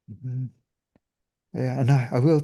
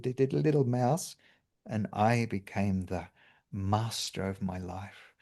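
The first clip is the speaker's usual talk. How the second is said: normal talking voice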